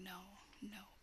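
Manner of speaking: usual speaking voice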